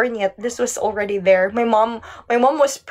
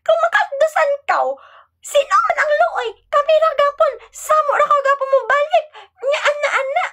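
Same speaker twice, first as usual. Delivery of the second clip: father berating daughter in dialect, mock-scolding high voice. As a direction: scold like an old-fashioned strict dad. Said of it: "Sila tatay".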